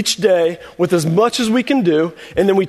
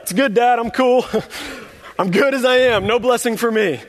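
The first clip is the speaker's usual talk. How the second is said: higher-pitched mock polite refusal; Judah declining; 5 semitones higher than usual. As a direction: beg off in a high voice, with a little laugh. Voices Judah nervously declining a blessing.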